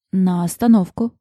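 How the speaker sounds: ordinary delivery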